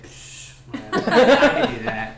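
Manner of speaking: scoff, then loud high boast; mock unimpressed kid, breaking into laughter; dismissive drow kids. Drow kids watching the kobold's win, dismissive scoff. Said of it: "the drow kids are like".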